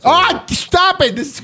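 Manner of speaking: drawn-out high mock disgust; slow exaggerated mock protest; mock outrage. Mock-outraged at smelling pits.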